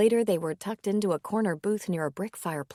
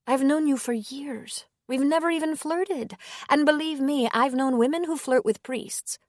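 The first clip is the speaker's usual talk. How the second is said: higher, hesitant woman's voice; 7 semitones higher, 3 dB louder than usual. A narrator's reading. Woman gently declining the priest's invitation, higher voice.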